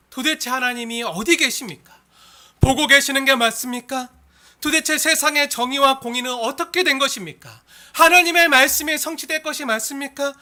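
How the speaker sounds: loud, pleading preacher's lament; crying out. Acted. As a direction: cry out to God in protest. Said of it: "그렇게 울부짖을 때". (하나님께 울부짖듯)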